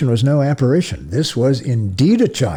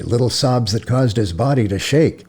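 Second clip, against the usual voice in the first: usual speaking voice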